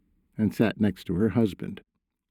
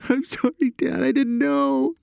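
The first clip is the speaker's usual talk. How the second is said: in tears. A narrator's reading. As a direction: wail the apology to Dad, slowing at the end. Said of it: "clearly in tears".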